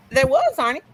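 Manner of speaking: high indignant exclamation; exclaiming. Real, not acted. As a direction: squeal it out in disbelief. Exclaiming to Arnie.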